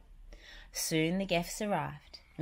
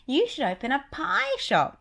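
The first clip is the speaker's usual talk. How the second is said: high, louder cartoon mouse; Mickey voice; 11 semitones higher, 7 dB louder than usual. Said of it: "Mickey agreed".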